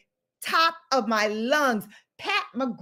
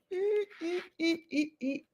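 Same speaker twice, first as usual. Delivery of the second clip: soft panicked squeaks; 6 semitones higher, 10 dB quieter than usual. Real, not acted. Squeals at spilled powder.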